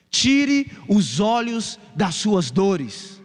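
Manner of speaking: very slow, weighty plea; slow appeal. Acted. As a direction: draw out each word of the plea. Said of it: "preacher's slow appeal". (apelo bem lento e solene)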